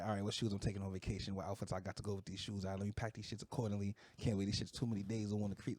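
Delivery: soft, fast thinking aloud; quiet inner voice. Retold or imitated. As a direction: rattle through the plan under your breath. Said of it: "I'm like".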